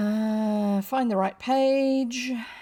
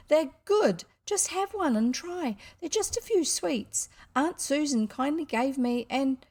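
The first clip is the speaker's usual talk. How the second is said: put-on high-pitched, insistent child; dogged boy; 6 semitones higher than usual. A narrator's reading. Son pleading the case for sweets, doggedly. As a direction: keep arguing doggedly. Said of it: "said William doggedly".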